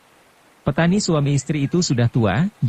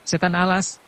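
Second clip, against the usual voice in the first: mildly angry, higher-pitched curse; understated high-pitched angry oath; angry cursing; 7 semitones higher than usual. A narrator's reading. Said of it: "Mendadak si nenek marah dan memaki".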